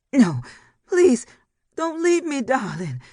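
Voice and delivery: very high, loud pleading; desperate begging. A narrator's reading. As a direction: beg him not to die. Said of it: "Ginger begged".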